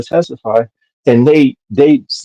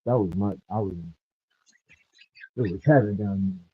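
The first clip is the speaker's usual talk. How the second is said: muffled; 12 dB quieter than usual. Real, not acted. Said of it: "you sound muffled".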